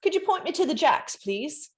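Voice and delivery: put-on a quick, high try at an Irish accent that slips into British; attempted Irish accent. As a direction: ask for the toilet in a mock Irish accent, fast and high. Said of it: "That's the wrong accent".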